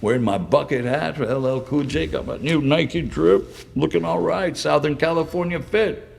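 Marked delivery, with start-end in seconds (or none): none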